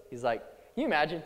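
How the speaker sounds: ordinary talk, nothing marked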